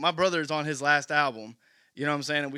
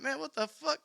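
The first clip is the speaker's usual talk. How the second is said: mock disbelief; 11 semitones higher, 5 dB quieter than usual. Brother's stunned reaction retold.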